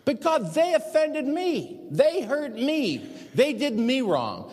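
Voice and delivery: high, slow, drawn-out mock complaint; aggrieved whining. Man pleads his hurt to God, acted aggrieved whine. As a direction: wail each grievance loudly, stretching the words.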